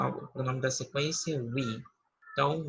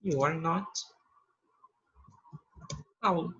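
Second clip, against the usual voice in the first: slow model reading; slower than usual. Shown as a demonstration. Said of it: "eu vou dizer".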